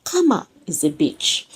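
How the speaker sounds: usual speaking voice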